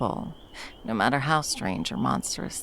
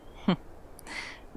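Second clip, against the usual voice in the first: quiet breathy chuckle; a low knowing laugh; soft laugh; 6 dB quieter than usual. Acted. Teasing the shivering partner, a soft chuckle.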